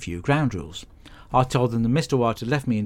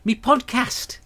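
irritated snap; 12 semitones higher, slower than usual. Acted. Snapping at roommate.

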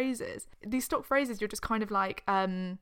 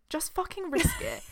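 high emphatic; 9 semitones higher than usual. Retold or imitated. Emphatic quoted urging.

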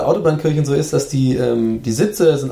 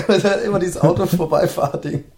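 ordinary talk, nothing marked

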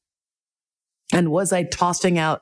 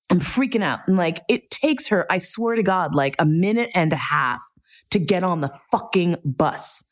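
usual speaking voice

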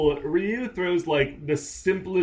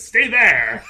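mock curt order; 8 semitones higher, 10 dB louder than usual. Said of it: "and says".

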